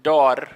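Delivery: "dor" with a light R, not the emphatic American one; weak R in dor. Shown as a demonstration. Teacher showing learners what to avoid, dor with a weak R. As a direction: say dor with an underdone R. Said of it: "I don't say".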